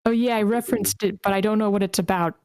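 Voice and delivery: ordinary talk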